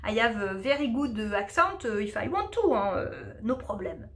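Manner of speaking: English with a put-on French accent. Shown as a demonstration. English teacher showing viewers she can do it, playful French accent. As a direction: demonstrate heavily French-accented English, ending in amusement. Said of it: "parler anglais avec l'accent français, hein, je peux le faire".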